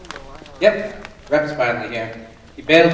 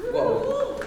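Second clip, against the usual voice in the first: surprised whoa; 4 dB quieter than usual. Acted. Guard hears extra bail request.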